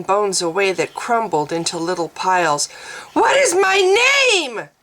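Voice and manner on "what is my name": high anguished cry, loud; a woman screaming in anguish; hoarse scream. Acted. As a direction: scream it out in despair. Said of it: "she screamed at them hoarsely".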